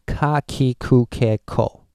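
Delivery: "Kakikukeko" slow, with a hard accent on each syllable; English-style Kakikukeko. Shown as a demonstration. Teacher showing how English speakers mispronounce it, slow and accented. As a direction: sound it out like an English speaker, every "ka" punched. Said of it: "you might well pronounce this as, like".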